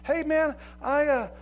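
lightly voiced busybody calling you out; a hint of a prying church member; nosy church member. Church people catching a believer's sins, mock-shocked. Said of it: "people are gonna go".

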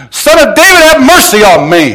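loud, high plea yelled to be heard; yelling. Acted. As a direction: call out desperately over a crowd. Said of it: "he just starts yelling".

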